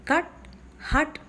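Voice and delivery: "cut" and "hut" with a short half-open vowel. Shown as a demonstration. Teacher giving examples of half-open vowels, short vowel in "cut", "hut". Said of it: "as you find in".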